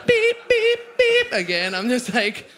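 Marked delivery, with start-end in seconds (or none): 0.1-1.3 very high, loud mimicked seatbelt beeps; mock seatbelt alarm; imitated car beep. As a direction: squeal the warning chime again. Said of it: "And then".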